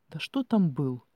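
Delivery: usual speaking voice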